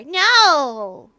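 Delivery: loud, high, drawn-out "no"; appalled refusal. Acted. Rejecting a suggestion to eat the child, appalled shout. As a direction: cry out a horrified refusal.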